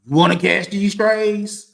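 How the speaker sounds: a mocking, stretched-out threat; taunting challenge. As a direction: dare her to start something. Taunting wife during argument.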